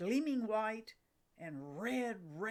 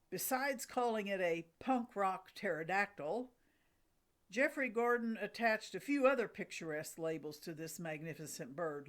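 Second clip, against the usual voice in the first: ordinary talk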